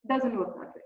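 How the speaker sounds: ordinary talk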